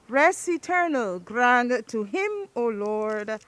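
ordinary talk, nothing marked

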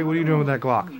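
usual speaking voice

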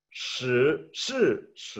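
"Shi, shi, shi" rising, falling, rising; Mandarin tones demo. Shown as a demonstration. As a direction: contrast the tones clearly. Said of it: "lo intentan en su casa".

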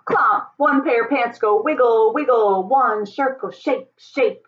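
plain reading voice

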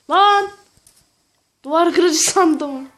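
loud, slow shocked outcry; surprised shout. Real, not acted. Realises mistake, shouts.

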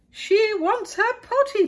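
very high, loud, slow alarm; cried out. A narrator's reading. Maid relaying the princess's need, loud high cry. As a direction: shout it up the castle in a panic. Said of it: "cried the maid".

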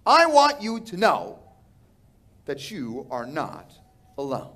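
usual speaking voice

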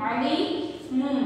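"अलीमुम" very slowly, ending on a long m; m ending, drawn out. Shown as a demonstration. Teacher repeating it for students, slow "अलीमुम" with hummed m. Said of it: "इधर पढ़ेंगे हम".

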